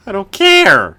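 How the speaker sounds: high loud outburst; frustrated shout. Real, not acted. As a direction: snap it out in frustration. Ranting about math class.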